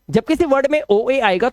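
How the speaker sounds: normal talking voice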